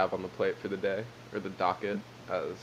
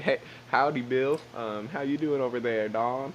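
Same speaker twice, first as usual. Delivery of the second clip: southern accent; 3 semitones higher than usual. Shown as a demonstration. Co-host demonstrates his own accent, exaggerated southern. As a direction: lean right into a folksy southern accent. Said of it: "full leaning into my southern accent".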